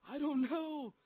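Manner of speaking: high, loud, distressed cry; panicked mock wail. Hurt player answering his coach, loud high acted panic. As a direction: wail it as if acting out your panic.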